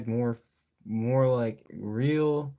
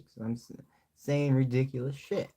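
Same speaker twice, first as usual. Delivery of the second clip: usual speaking voice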